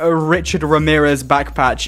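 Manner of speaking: ordinary delivery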